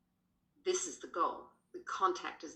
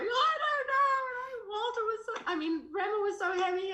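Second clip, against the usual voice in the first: retold crying; 6 dB louder than usual. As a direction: replay bursting into tears, voice pitched way up. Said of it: "I just burst into tears".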